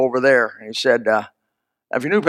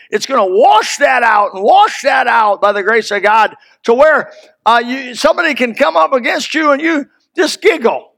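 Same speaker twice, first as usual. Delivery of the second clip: higher, louder fervent preaching, building; preaching at full force; 8 semitones higher, 4 dB louder than usual. Acted. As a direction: preach it out, rising with fire. Preaching on washing by the word.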